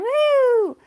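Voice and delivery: loud, high cheer; excited woo. Acted. Reading a viewer's celebration comment, loud high cheer.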